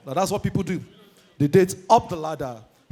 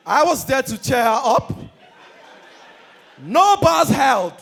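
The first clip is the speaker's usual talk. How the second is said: usual speaking voice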